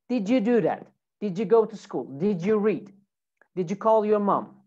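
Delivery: each "did you" said as "didja" or "didjoo"; "did you" joined with a J; linked J sound. Shown as a demonstration. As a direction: blend "did you" into J at each question's start. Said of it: "it makes a J sound".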